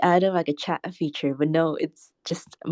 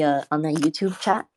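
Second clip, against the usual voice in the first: ordinary talk, nothing marked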